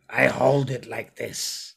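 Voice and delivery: slow goblin voice; deliberate, put-on goblin speech; guarded goblin. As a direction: answer slowly as a wary goblin. Game master voices goblin showing stone.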